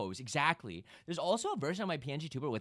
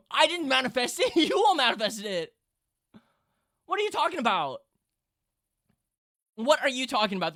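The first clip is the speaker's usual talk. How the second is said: higher, louder laughing denial; excited protest; 8 semitones higher, 9 dB louder than usual. Real, not acted. Streamer denying she caused it, loud and excited. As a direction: deny it loudly to chat.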